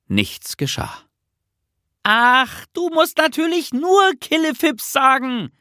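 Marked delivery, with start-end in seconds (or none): 2.0-5.5 very high, impatient call from below. A narrator's reading. Nisse corrects Bertil from below, calling up impatiently. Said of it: "rief Nisse von unten herauf".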